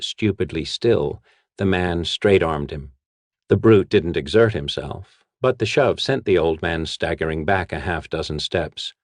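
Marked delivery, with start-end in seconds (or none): none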